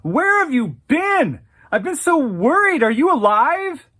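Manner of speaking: high, loud, fast panicked greeting; frantic worried cry. Acted. Worried friend crying out on seeing her return. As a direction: call out in desperate relief.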